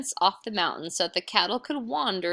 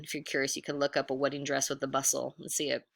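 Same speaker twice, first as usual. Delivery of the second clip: ordinary delivery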